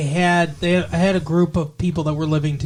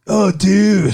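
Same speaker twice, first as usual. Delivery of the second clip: loud, higher California surfer drawl; mock surfer dude accent; 4 semitones higher, 8 dB louder than usual. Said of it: "Surfer dude accent".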